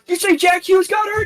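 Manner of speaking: high, eager dad calling down. Retold or imitated. Dad coming down the stairs, high eager question. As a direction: perk up like a fan dad hearing news. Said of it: "So his dad comes down and he's like".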